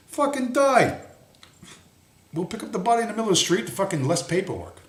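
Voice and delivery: sneering mock police voice; mock callous cop. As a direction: voice the cops' indifference. Voicing indifferent police.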